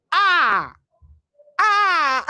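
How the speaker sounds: high "Ah!" twice, then a startled laugh; loud, high gasp of disbelief into laughter; shocked cry then laugh. Real, not acted. Reacts to kerosene threat.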